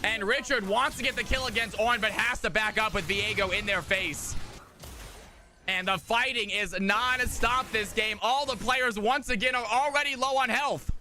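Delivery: energetic game commentary; live play-by-play. Acted. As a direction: narrate the action with rising hype. Esports play-by-play casting.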